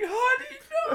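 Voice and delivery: hammed-up horrified mother; mock mom scream. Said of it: "she's just like".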